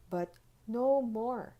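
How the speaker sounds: firm final warning. Acted. Tooth Fairy to Holly, slow and firm. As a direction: put your foot down, slowly. Fairy firmly refuses more.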